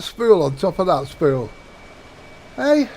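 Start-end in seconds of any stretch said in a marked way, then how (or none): 2.5-2.9 excited. Real, not acted. Proud of stacked spools.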